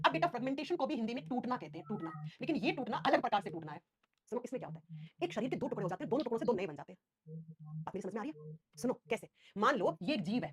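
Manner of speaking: normal talking voice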